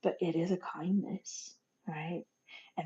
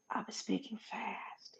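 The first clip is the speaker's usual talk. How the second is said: slow whispered "I was speaking fast"; whispered admission; 3 semitones higher, 11 dB quieter, slower than usual. Real, not acted. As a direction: admit it softly in a whisper. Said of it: "confession softened".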